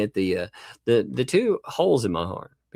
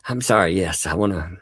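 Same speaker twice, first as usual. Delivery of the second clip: usual speaking voice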